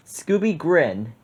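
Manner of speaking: plain reading voice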